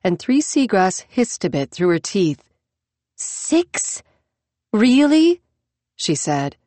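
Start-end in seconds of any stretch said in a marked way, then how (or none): 3.2-5.4 shocked disbelief, hiss then exclaim; incredulous. Acted. Shocked at destination.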